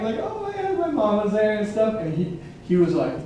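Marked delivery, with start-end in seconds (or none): 0.2-1.6 high, light mock-tearful quote; high, put-on weepy voice; imitated crying. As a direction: imitate someone crying with relief. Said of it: "like, crying".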